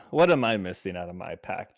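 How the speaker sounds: usual speaking voice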